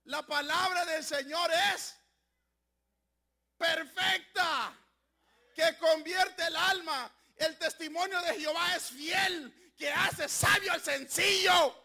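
loud, slow, rising sermon cry; full-force Pentecostal preaching; fiery preaching. Acted. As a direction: preach it out to the congregation.

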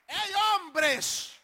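high, loud hail; shouted summons; calling out. Acted. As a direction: holler to a group of men. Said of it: "Les dijo".

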